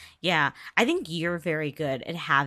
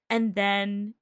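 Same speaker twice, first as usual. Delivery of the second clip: ordinary delivery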